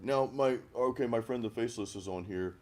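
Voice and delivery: ordinary talk, nothing marked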